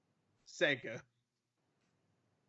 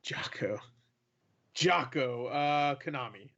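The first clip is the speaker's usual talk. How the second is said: normal talking voice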